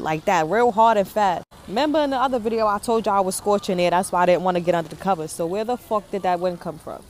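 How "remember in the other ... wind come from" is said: ordinary talk, nothing marked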